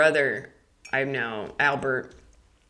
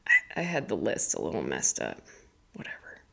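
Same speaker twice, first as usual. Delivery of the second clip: low whispered apology to self; whispered aside; 13 semitones lower, 8 dB quieter than usual. Real, not acted. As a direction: mutter it away to yourself. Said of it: "muttered aside".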